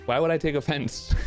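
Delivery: normal talking voice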